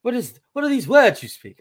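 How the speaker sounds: put-on high, loud prim British-style child voice; proper English kid. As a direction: ham up a posh little English boy. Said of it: "Who is this English".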